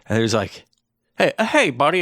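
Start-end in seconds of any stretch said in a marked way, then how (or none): 1.2-2.0 high-pitched mock New Hampshire local; hammed-up New Hampshire accent. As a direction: put on a much higher New England voice. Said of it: "It's New Hampshire, I guess".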